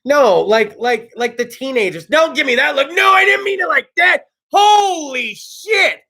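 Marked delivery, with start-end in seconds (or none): 2.9-4.2 defensive protest. Real, not acted. Talking to his girlfriend, a loud defensive protest. 4.5-6.0 slow, high, stretched exclamation; stunned exclaim. Real, not acted. After being checked by his girlfriend, a stunned slow exclamation. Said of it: "checked me on that one".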